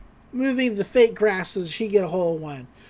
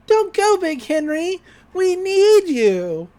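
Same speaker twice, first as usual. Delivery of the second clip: put-on much higher, slow little girl voice; little girl pleading; 9 semitones higher, 4 dB louder, slower than usual. Little golf ball girl begging Big Henry to stay, high and slow. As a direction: beg him to stay like a tiny girl. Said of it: "a smaller little girl golf ball is like".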